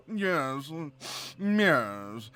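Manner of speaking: posh British yes with a hummed M in front; drawn-out, blustery British toff; blustering posh British. Shown as a demonstration. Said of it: "a little bluster".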